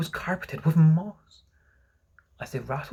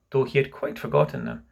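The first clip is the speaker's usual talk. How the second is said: ordinary delivery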